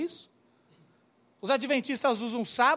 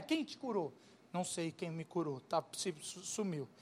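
hushed exchange; 7 semitones lower, 11 dB quieter than usual. Acted. Priest and healed man, hushed exchange.

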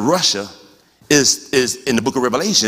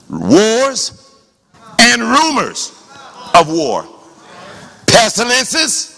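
raised, slow preaching cry, one sign at a time; preacher's proclamation; 6 semitones higher, much slower than usual. Real, not acted. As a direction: ring out each phrase high, with pauses between. Preacher proclaims scriptural signs of end times.

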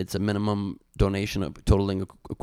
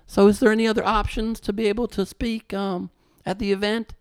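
much higher, louder Southern woman's voice; bad Southern accent; 13 semitones higher, 5 dB louder than usual. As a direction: put on a hammed-up Southern belle. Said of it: "this Southern belle".

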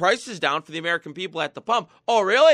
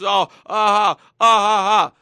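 loud sarcastic uh-huh, dragged out; mocking uh-huh; 7 dB louder than usual. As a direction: scoff at her like she's clueless. Mocking the secretary's answer.

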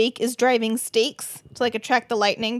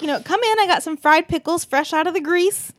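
higher-pitched, eager imitation of a hostess; folksy hostess invitation; 5 semitones higher than usual. Pearl inviting her guest in for fried pickles, eagerly. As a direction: play a welcoming mama, pitched up.

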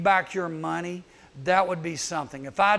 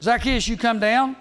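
calling out; 2 semitones higher, 6 dB louder than usual. Acted. Preacher calls Jesus' words.

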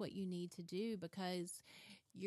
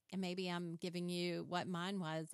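ordinary talk, nothing marked

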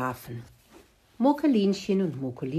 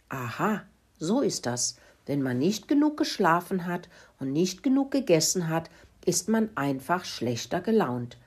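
ordinary delivery

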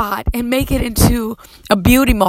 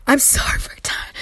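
a soft, breathy, tearful apology; barely voiced, overcome with feeling; tearful whisper; 4 semitones higher, 4 dB quieter than usual. Real, not acted. Preacher apologizing to her congregation for the time, in a tearful whisper. Overcome with emotion mid-sermon.

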